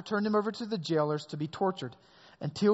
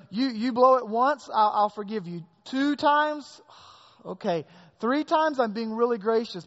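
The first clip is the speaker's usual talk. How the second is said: raised, self-congratulating bargaining voice; self-satisfied Peter; 5 semitones higher, 4 dB louder than usual. Retold or imitated. Peter bargaining with Jesus over forgiveness, smug and raised. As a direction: count up his generosity proudly. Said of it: "He's saying, you know".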